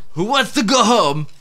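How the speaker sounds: high, loud muddled accent, hammed up; mock Van Damme accent. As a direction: shout it like a muddled action-movie Belgian. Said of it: "Jean-Claude sounds like some, he's weird ass fucking muddled accent".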